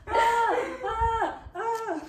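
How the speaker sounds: high squealing laugh burst; high giggle. Acted. High laugh before the skit.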